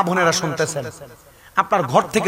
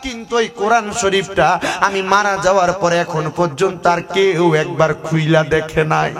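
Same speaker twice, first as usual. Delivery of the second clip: melodic waz preaching; 2 semitones higher, 4 dB louder than usual. Acted. Preacher tells congregation of a weeping grave, chanted sermon. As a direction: preach the tale in singsong, stretching the line endings.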